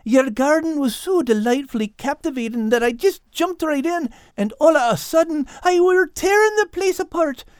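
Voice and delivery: put-on stammering, accented apology; groveling. A narrator's reading. Cat Al begs forgiveness for wrecking the garden, groveling. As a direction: plead nervously, stumbling over the first word. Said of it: "groveled Al".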